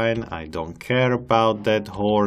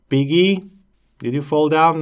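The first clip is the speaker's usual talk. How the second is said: sweet high pet-talk; 7 semitones higher, 8 dB louder than usual. Real, not acted. Coaxing a fallen pig, high cooing voice.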